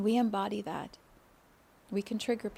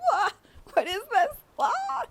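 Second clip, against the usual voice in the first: squeaky high shocked "wah", much louder; high-pitched playful shock; startled gasp; 13 semitones higher, 7 dB louder than usual. Retold or imitated. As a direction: act out an overwhelmed rush of feeling. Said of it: "You might be like".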